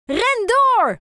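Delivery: high, loud cheering shout; both cheer loudly at the race; shouting together. A narrator's reading. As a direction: yell them on to the finish. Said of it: "roepen ze tegelijk".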